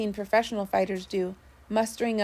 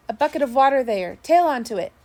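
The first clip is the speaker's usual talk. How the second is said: higher, louder call across the deck; shouted order; 4 semitones higher, 6 dB louder, faster than usual. A narrator's reading. Mate calls the crew for water, loud shouted order.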